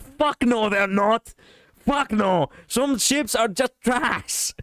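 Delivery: high-pitched, emphatic objection; flatly refuse, pitch leaping up; worked-up, incredulous rejection; indignant outburst. Real, not acted. Indignantly rejects that ships are valid.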